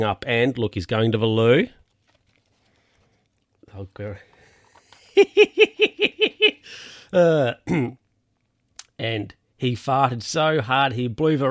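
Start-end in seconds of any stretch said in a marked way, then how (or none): 5.2-6.8 high, loud burst of laughter; real laugh. Real, not acted. Laughing at farting grandpa.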